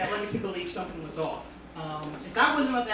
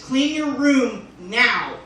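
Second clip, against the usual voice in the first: loud, slow, posed command; hammed-up stern command; mock angry order; 3 semitones higher, 8 dB louder, much slower than usual. Shown as a demonstration. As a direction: draw it out with a pointing gesture. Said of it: "shoot his hand out like this".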